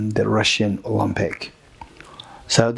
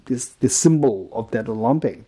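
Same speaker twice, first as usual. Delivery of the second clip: ordinary delivery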